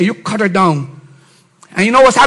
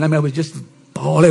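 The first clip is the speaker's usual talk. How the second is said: usual speaking voice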